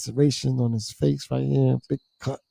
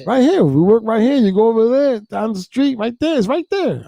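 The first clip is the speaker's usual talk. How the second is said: eager helper voice; 8 semitones higher than usual. Retold or imitated. Worker giving directions, retold.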